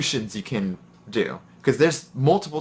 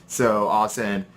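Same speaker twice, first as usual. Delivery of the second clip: ordinary talk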